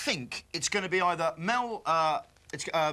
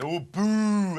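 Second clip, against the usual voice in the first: mock audience boo; 4 dB louder than usual. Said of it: "everyone boos the guy and goes".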